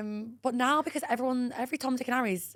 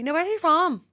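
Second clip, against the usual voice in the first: ordinary talk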